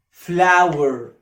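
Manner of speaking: فلاور said very slowly as flower. Shown as a demonstration. Teacher modelling فلاور slowly. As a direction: draw out فلاور.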